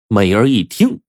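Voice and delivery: ordinary delivery, nothing marked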